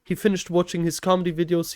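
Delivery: normal talking voice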